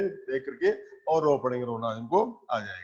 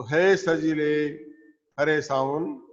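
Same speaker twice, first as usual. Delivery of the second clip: poem recital; 4 dB louder, much slower than usual. Shown as a demonstration. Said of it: "teacher recites the poem line".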